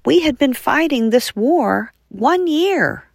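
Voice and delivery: usual speaking voice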